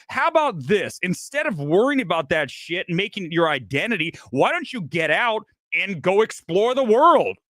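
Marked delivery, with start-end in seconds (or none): none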